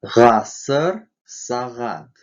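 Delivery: "Г" in "Гасыр, сагат" as a voiced back fricative; "Г" voiced far back in both words; voiced back-tongue consonant. Shown as a demonstration. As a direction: say "Гасыр, сагат" with air rubbing at the back palate. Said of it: "заднеязычный".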